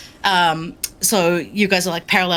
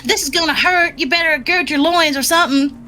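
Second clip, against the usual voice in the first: much higher, put-on Midwestern voice; imitation Midwestern American accent; 8 semitones higher than usual. Game master warns players in the requested accent, mock Midwestern American. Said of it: "your best of a Mont accent".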